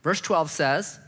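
ordinary talk

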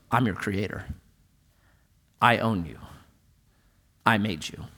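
voice of the creator. Acted. Jesus tells the paralytic man he made him, low and solemn. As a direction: speak it low as the one who made them. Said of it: "what Jesus was saying was".

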